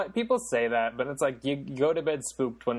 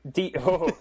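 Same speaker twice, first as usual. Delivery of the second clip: ordinary delivery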